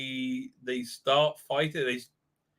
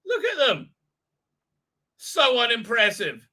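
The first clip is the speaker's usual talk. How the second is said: ordinary delivery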